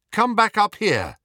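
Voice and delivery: high, loud summons; calling out. A narrator's reading. Harry calling Hermione back up the stairs, calling out. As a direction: call urgently up and away.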